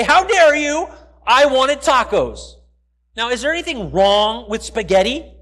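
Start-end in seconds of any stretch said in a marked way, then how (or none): 0.0-2.6 loud, slow, hammed-up outrage; husband protesting to his wife, in mock outrage; mock indignant complaint. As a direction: play the wronged husband, loud and drawn out. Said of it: "raise up my voice in complaint and say".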